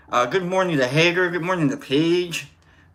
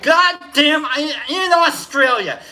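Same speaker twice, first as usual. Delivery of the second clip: mock outburst; 10 semitones higher, 5 dB louder, slower than usual. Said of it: "then you're like".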